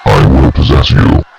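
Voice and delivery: low, loud booming demon. Acted. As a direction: roar it out like a demon. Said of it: "change my voice to sound like a demon".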